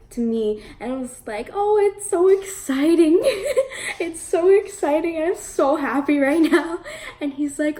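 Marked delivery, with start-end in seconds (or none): 1.5-6.8 high, loud, giggly excitement; re-enacted giddy gushing, pitched up; nervous fangirling. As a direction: gush like a starstruck fan, laughing. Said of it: "so freaking nervous and fangirling".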